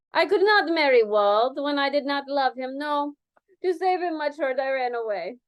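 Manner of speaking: put-on Spanish accent. Dancer voiced with accent.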